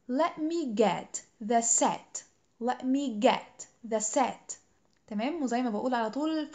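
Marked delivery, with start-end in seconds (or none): 0.1-4.6 very slow "Let me get the set.", each word separate; drawn-out model line to repeat; slow model sentence. Shown as a demonstration. Teacher says the sentence slowly for students to echo. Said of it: "حاولوا تقولوها ورايا".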